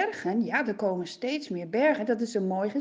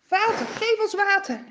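higher, louder angry shouting; shouting angrily; 8 semitones higher, 7 dB louder than usual. Acted. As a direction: hurl complaints at Moses. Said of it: "beginnen ze allemaal te roepen en te schreeuwen".

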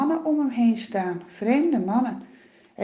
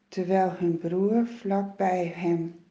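ordinary delivery

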